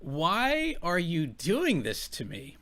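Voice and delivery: slow, drawn-out mock complaint; mock whining. Host echoing the man's complaint back at him, mockingly slow. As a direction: draw out each word in mocking disbelief.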